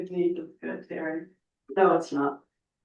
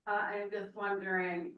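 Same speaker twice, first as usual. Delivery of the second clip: much softer, hard to hear; too quiet to hear; 10 dB quieter than usual. Real, not acted. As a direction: say it quietly, barely carrying across the room. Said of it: "Could that person speak up?".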